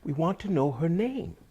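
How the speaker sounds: high-pitched farmer voice; Saeed pleading his case. Acted. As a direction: explain earnestly in a high voice. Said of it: "And he said".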